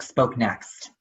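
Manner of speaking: ordinary talk, nothing marked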